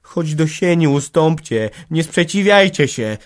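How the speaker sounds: high, loud, urgent begging; pleading. A narrator's reading. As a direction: beg them to give way. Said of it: "błagała".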